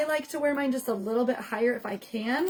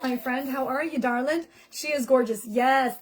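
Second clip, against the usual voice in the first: normal talking voice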